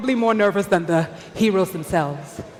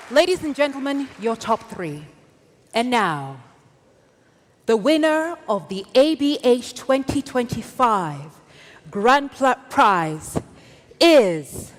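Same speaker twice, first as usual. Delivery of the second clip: booming prize announcer. Acted. Host announcing the grand prize winner.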